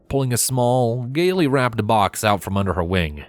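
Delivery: ordinary delivery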